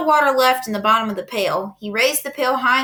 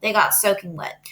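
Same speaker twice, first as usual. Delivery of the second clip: plain reading voice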